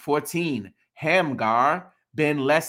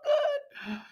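delighted squeal. Real, not acted. Reacting to a funny name.

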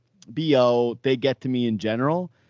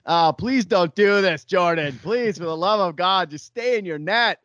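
exasperated plea, pitched up; mock pleading; 4 semitones higher than usual. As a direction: beg him like a despairing fan. Acted plea to goalie not to fight.

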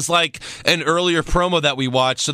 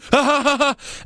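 high-pitched put-on villain laugh; mock high cackle; 12 semitones higher than usual. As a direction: cackle in falsetto, hammed-up. Cackle ending the Bray imitation.

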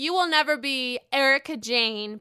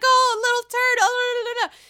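much higher and louder mock voice; imitating Leah; 12 semitones higher, 9 dB louder than usual. Vlogger mimicking Leah finding the turd, high and loud. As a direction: squeal it like a prissy lady. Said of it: "and she's like".

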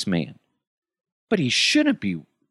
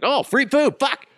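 high, loud yelp over free food; greedy joy; frantic excitement; 4 dB louder than usual. Retold or imitated. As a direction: jump at it like he's starving. Greedy coworker spotting food.

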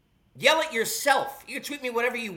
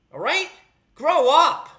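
1 scolding shout; 6 semitones higher, 6 dB louder, slower than usual. Real, not acted. Host scolding fans.

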